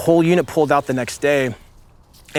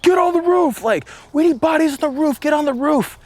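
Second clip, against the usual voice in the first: retold scream; 13 semitones higher than usual. Marine screaming for bodies on the roof, a high mock scream. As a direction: scream it up the stairs to get men on the roof. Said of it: "this scream".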